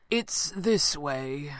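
very slow, assured and smiling; smiling stranger. A narrator's reading. Max leads the companions on, slow and smiling. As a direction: draw it out with a pleased smile. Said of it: "Max said with a smile".

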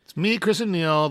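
normal talking voice

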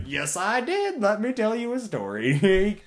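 high, loud boast; proud retort. Retold or imitated. Answering a doubting coworker, high and loud. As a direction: puff up and brag.

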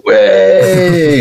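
a bar crowd cheering a dropped pint; mock crowd cheer. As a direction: cheer at him like a whole pub. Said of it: "have everyone turn around and go".

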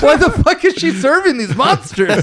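high, loud, laughing disbelief; laughing incredulity. Real, not acted. Podcaster to co-hosts about the waitress serving monsters, laughing incredulity. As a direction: burst out baffled, laughing through it.

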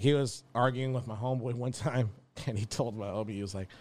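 normal talking voice

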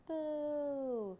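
high, long, held boo; children's boo. A narrator's reading. Children boo the dragon, long high jeer. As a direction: hold out a high jeering boo. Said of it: "shouted the old woman's children".

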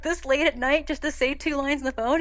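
ordinary talk, nothing marked

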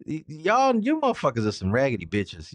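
normal talking voice

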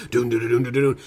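low mock drumbeat dun dun; hummed-up battle drums; imitated drums. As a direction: thump out the drums like a soundtrack. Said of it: "you got these drums".

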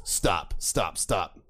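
low, falling stops with gaps between; stern instructor. Acted. Instructor cutting off a recruit, low stern command. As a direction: halt them with deep, measured repetition.

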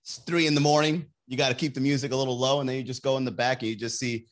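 usual speaking voice

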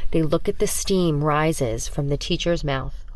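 usual speaking voice

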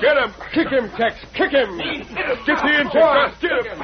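high frantic shouts; yelling in a brawl. Acted. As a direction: egg on the fighters, full yell. Outlaws brawling, urging each other.

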